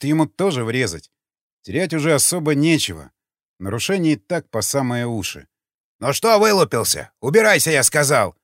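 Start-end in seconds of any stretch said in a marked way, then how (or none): 6.0-8.4 put-on old guard yelling. A narrator's reading.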